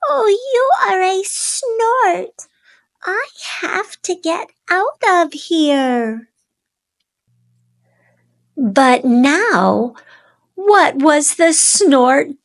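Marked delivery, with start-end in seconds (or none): 0.1-6.3 put-on scared baby bird.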